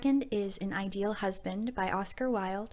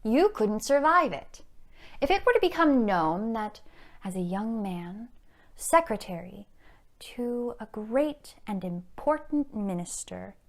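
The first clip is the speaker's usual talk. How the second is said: arch, menacing society blackmailer; Mrs Cheveley; 6 dB louder than usual. Acted. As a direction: toy with your victim, then threaten scandal. Performs Mrs Cheveley's monologue.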